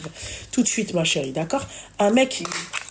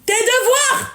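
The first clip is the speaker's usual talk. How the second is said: calling out; 11 semitones higher, slower than usual. Real, not acted. Calling out to her daughter.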